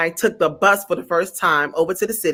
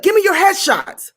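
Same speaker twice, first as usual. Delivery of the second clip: pitched way up, summoning; high bossy diva; 11 semitones higher than usual. Retold or imitated. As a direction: call her up like a diva. Director voiced high.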